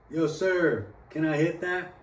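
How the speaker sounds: retold calling out. Friend asking a stranger for a hit, calling out. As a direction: act out a friend's upbeat request.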